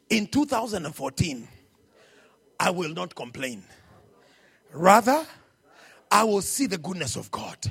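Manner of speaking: low, measured prayer phrases with long pauses; leading a confession. Real, not acted. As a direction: lead them phrase by phrase, swelling towards the end. Leads congregation in a confession.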